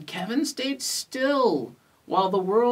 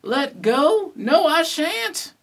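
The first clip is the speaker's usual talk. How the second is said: high, loud storybook refusal; raised, high-pitched protest; shouting; 8 semitones higher, 5 dB louder than usual. A narrator's reading. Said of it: "shouted Kevin".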